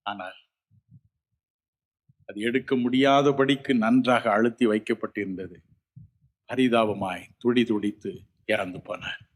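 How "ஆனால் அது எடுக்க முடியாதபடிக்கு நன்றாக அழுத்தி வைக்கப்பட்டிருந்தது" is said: usual speaking voice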